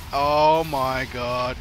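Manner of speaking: ordinary talk, nothing marked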